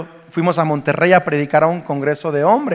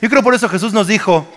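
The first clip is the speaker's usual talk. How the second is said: ordinary talk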